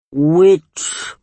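usual speaking voice

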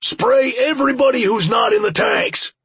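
high shouted command; yelled. A narrator's reading. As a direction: shout the order to the whole team. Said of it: "the boss yelled from behind us".

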